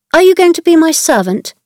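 proud, unfriendly. A narrator's reading. Mary questioning the new servant, proud and cold. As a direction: look down on the maid. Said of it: "in her proud, unfriendly way".

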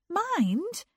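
quick high warning snap; older sister's sharp "Mind". A narrator's reading. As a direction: cut in sharply to stop her sister. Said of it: "said Frances sharply".

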